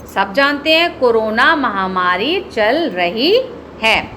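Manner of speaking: ordinary talk, nothing marked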